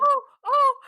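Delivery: high, loud flustered oh, oh. Retold or imitated. Relative caught out at the dinner table, high flustered oh. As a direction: mimic an embarrassed relative caught out. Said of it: "she went".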